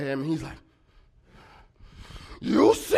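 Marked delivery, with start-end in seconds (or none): 2.4-3.0 mock furious scream, drawn out on SEE; loud, long, hammed-up outburst; enraged catchphrase. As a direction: imitate a brother blowing up, huge rasping yell. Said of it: "catchphrase right before he went off".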